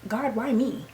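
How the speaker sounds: rapid, run-together venting; fast rant. Retold or imitated. Crying woman waving off her coworker, fast rant. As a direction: tumble out the words. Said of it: "I'm like".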